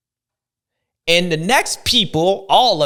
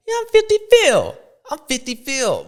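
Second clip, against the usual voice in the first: hyped callout; 10 semitones higher, 4 dB louder than usual. Acted. Joking self-label.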